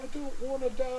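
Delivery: high, soft terrified plea; scared whimper. Acted. Clevis pinned down, whimpering. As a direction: beg for your life.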